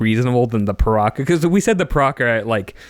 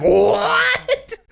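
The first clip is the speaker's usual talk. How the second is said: a much higher squeaked What with a laugh; shocked what. Retold or imitated. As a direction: squeal it in disbelief. Shocked Takadox reacts.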